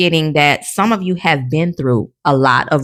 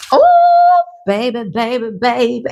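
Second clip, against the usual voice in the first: excited exclamation; 6 semitones higher than usual. Real, not acted. Reader surprised by a new message, squealing baby. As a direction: burst out with delight.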